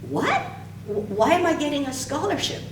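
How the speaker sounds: sharp jump way up in pitch, stunned; retold disbelief. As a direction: replay your own shocked 'what?' at the news. Retold shock at scholarship offer.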